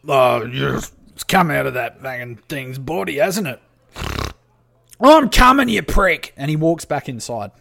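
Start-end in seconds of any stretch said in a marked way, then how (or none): 0.1-3.6 rough-and-ready orc, matter-of-fact; put-on orc character talk. Game master plays the orc. 5.0-6.3 high, very loud irritated holler; exasperated orc shout; orc yelling back. Acted. Orc yells back toward inside.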